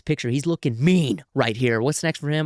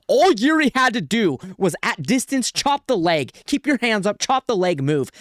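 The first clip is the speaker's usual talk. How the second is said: heated rant; 8 semitones higher than usual. Real, not acted. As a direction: yell the game plan he should have followed. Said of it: "Apologies for getting heated".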